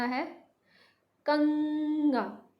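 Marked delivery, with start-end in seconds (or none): 1.3-2.5 कंगा held long on the nasal ंग; drawn-out nasal demo. Shown as a demonstration. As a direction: stretch कंगा so ंग rings. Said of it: "आपको अंग की पूरी आवाज़ आपको सुनाई दे रही है". (कंगा को खींचकर, ंग पर ज़ोर)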